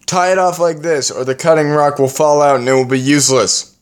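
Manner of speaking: high, hurried correction; upset instruction. Acted. He shows his mate the knot, agitated and high.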